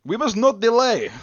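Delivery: high, loud, put-on guard voice; guard's attempted accent. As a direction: bark the order in a big put-on voice. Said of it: "I can't do his accent".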